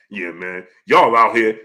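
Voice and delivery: puffed-up mock voice dropped low; chest-out imitation. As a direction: mimic his chest-out boast in a low pitch. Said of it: "You said it with your chest out".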